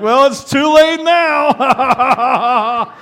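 very slow, high and loud, laughing through it; cackling, too late now. Retold or imitated. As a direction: crack up as you say it. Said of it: "We're like".

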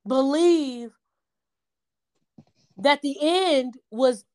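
slow, emphatic sermon cadence; measured preacher's challenge, each "how dare you" drawn out; preaching. Acted. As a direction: preach it slowly, daring the listener to doubt. Preaching to listeners, repeated challenge.

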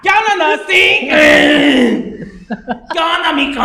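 mock flirty greeting. Imita a chicas del DF. (saludo coqueto chillón imitado, más agudo y fuerte)

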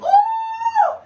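long, very high "ooh"; high whoop. Real, not acted. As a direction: let out a preacher's whoop. Preacher whoops mid-sermon.

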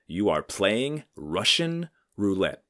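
slow, grave doctor voice; stern old doctor. Retold or imitated. Old stern doctor warning his young patient, slow and grave. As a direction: deliver the verdict slowly and gravely like an old-school doctor. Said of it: "he looks at me and he goes".

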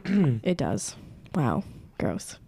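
low and dropping, deadpan disgust; mock disgust. Real, not acted. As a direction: sink it down, grossed out.

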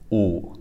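"oo" with limited lip rounding; froggy pinyin u. Shown as a demonstration. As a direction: hold back the rounding on "oo", a little froggy. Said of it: "like that".

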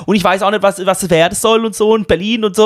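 high, chatty Swabian woman imitation; mimicked Swabian brush-off; Swabian accent. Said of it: "wie sich das anhört auf Schwäbisch".

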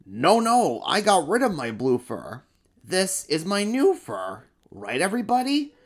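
high, loud, flustered denial; flustered emperor. Acted. Emperor insists to the crowd it's new fur. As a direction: insist and look for support.

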